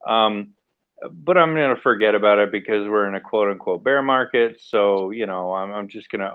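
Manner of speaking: ordinary talk, nothing marked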